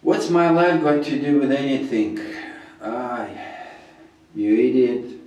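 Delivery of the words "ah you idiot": ordinary delivery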